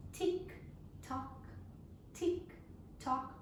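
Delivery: soft clipped tick tock; quiet clock ticks. Acted. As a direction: tap out the clock's ticking softly. Ticking clock sound effect.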